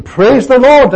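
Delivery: high, loud shout of praise the Lord; top-of-voice shout. Retold or imitated. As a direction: yell it at the top of your voice. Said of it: "as loud as he could shout".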